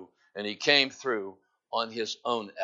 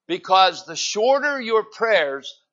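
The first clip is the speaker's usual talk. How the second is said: loud, slow preaching; preaching at full force; 5 semitones higher, 8 dB louder, slower than usual. Acted. Pastor preaching to the church, loud and slow. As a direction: drive the point home from the pulpit.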